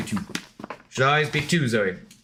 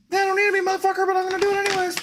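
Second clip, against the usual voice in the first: much higher fast sassy dog voice; voicing the sassy dog; much faster than usual. Streamer voicing his defiant dog, much higher and fast. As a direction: squeak the dog's defiant reply fast and high. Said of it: "It's like".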